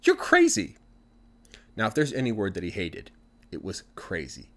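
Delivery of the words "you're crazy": high, loud, exasperated put-down; irritated wife. A narrator's reading. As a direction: squeal it out, fed up with him. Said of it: "looks at him really irritated and says".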